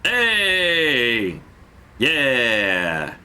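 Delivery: drawn-out, falling celebratory cheer. Real, not acted. Gamer celebrating a success, drawn-out cheer. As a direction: stretch out each word in celebration.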